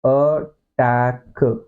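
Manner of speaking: अटैक broken slowly into sounds, "uh - taa-k"; Hindi spelling pronunciation. Shown as a demonstration. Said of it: "तो इसको लिखते ऐसे". (अटैक को तोड़कर धीरे, 'अ' से शुरू)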